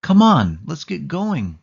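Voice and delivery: loud, high and commanding; bossy girl herding her friends. A narrator's reading. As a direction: hurry everyone along impatiently. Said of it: "said in a bossy voice".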